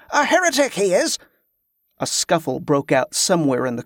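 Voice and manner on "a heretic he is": high accusing shout. A narrator's reading. Pilgrim denouncing Tezcah to the crowd, high shout. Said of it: "came another shout".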